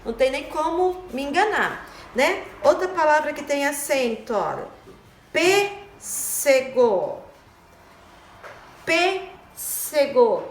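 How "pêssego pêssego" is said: "pêssego" very slow, PÊ held apart from ssego; drawn-out "pêssego" for a class; stress on PÊ. Shown as a demonstration. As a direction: sound out "pêssego" with a long first syllable. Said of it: "Da sílaba tônica P".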